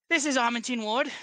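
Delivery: put-on a light, lightly held Ondarian accent that leans French; heavy Ondarian accent. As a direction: introduce yourself in a hint of heavy Ondarian. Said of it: "a very heavy Ondarian accent".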